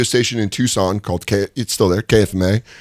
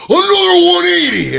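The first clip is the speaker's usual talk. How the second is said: very high loud raspy yell; Macho Man impression; 5 dB louder than usual. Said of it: "And he goes".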